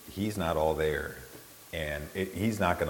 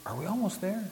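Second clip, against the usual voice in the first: high-pitched pleading question; retold eager question in a raised pitch; hopeful asking; 12 semitones higher, 3 dB quieter than usual. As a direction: call over to the guide, high and hopeful. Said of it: "I'd turn to the person".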